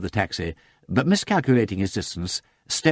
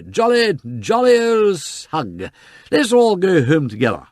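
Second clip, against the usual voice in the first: much higher, loud, slow tipsy greeting; drunk cheer; 10 semitones higher, 6 dB louder, slower than usual. Acted. Freddy hails Sugg, high and loud. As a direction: greet everyone like a merry drunk.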